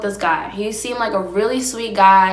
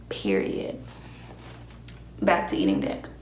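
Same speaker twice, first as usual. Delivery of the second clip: muttered aside; 12 semitones lower, 11 dB quieter than usual. Real, not acted. Low aside while eating.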